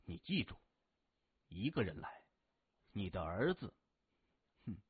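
low, soft, with deliberate gaps; menacing instructions. A narrator's reading. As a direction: lay down the terms quietly, phrase by phrase. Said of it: "接着嘱咐说". (低沉缓慢停顿，冷冷叮嘱)

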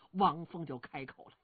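usual speaking voice

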